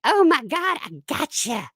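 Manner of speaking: high-pitched mock gloating; mocking detractors. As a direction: squeal it high like a gloating critic. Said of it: "They're like".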